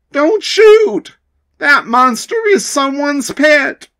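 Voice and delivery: high, loud urgent plea; child begs them not to shoot the pet; urgent shout. Acted. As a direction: stop the shot in alarm. Stopping the gunfire.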